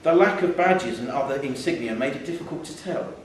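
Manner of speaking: ordinary delivery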